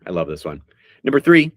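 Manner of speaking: ordinary delivery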